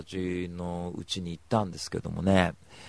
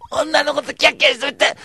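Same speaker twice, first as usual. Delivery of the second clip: mock whine; 11 dB louder than usual. Mock wail over his own fun.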